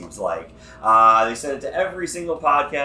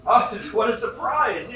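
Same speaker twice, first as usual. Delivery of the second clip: mock surprise; 9 semitones higher than usual. Staged surprise reveal.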